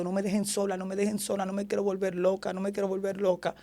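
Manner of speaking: soft, repeated plea, as if crying; understated imitation of crying; retold tearful plea. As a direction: beg under your breath not to be left. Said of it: "llorando, llorando".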